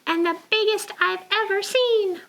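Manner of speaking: high little penguin voice. Acted. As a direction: compliment as the penguin. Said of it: "said Penguin".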